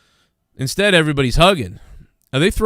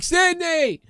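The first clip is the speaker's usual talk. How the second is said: very high mock call of Sydney; mock shouted name. Reporter cutting her off, high mock shout of her name. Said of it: "he's like".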